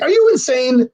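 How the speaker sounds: high, loud-ish retold disbelief; incredulous. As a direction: blurt it out, appalled. Reacts to Chad's absurd request.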